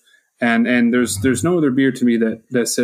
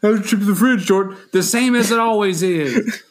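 high mock host voice mimicking co-host; imitating TJ; 8 semitones higher than usual. Jordan mocking TJ's question in a high voice. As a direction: parody of his co-host's sing-song question. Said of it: "that's the T J inflection".

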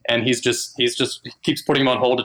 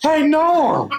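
much higher cheery call-out; goofy character greeting; 3 dB louder than usual. Retold or imitated. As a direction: greet him brightly and obliviously. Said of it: "he kept calling Colin Norm".

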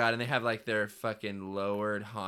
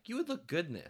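parent; 9 semitones higher than usual. Retold or imitated. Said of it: "Parents are the best at being like".

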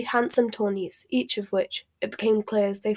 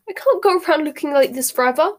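distressed; 6 semitones higher, 6 dB louder than usual. A narrator's reading. Hermione upset.

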